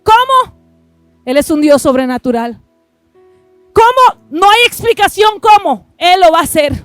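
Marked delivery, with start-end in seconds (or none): 0.0-1.2 sharp, much higher exclamation; high rhetorical cry. Real, not acted. Pastor asks the crowd how, cried out high. 3.7-5.9 preacher shout. Real, not acted. Rhetorical cry in sermon.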